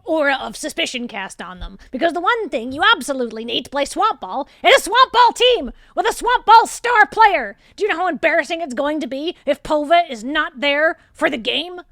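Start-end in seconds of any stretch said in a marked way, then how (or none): 1.9-11.8 high, loud, worked-up rant; agitated boss. Acted. Boss frets to her enforcer about the star player, agitated and loud. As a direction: build to an exasperated, high-pitched peak.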